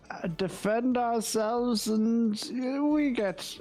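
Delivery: lightly voiced adventurer. Adventurer shrugs off the blood to the innkeeper, breezy. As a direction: brush it off like routine work. Player voices his character in-game.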